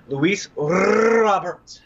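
"Luis Robert" with a long, trilled rolled R in "Robert", drawn out high and loud. Shown as a demonstration. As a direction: roll the R in "Robert" hard and stretch the name slowly like an announcer. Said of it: "Let's roll that R a little bit".

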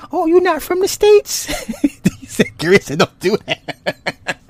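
very high, laughter-choked voice; laughing hard. Real, not acted. Host teasing about a friend from across the pond, laughing hard. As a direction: squeal it out through helpless laughter.

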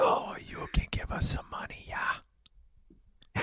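hammed-up Midwestern whisper; whispered Midwestern accent. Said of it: "That's my Midwestern accent".

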